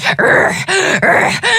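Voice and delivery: effortful urgh; straining grunt. Acted. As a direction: grunt as she pushes through. Witch straining through brambles.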